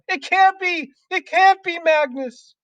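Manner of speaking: high, loud disbelief; horrified cry. A narrator's reading. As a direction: cry out in panicked denial. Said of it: "she cried".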